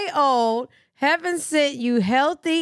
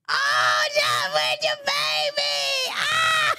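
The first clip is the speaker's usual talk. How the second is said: very high, drawn-out squealing coo; real high-pitched delighted scream; ecstatic squeal; slower than usual. Real, not acted. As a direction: squeal with joy over a newborn. First sight of the baby photo.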